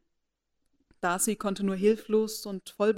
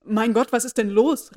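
startled, louder exclamation; alarmed gentleman, loud surprise; surprised; 3 semitones higher, 9 dB louder than usual. A narrator's reading. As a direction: blurt it out in shock. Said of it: "weniger höflich als überrascht".

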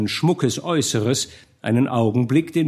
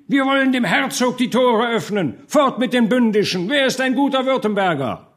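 high, raised rallying cry; shouting; 11 semitones higher, 3 dB louder than usual. A narrator's reading. Peaceful burgher turns to demand open gates, shouting. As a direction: cry out as to a crowd. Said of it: "erhob er seine Stimme und schrie".

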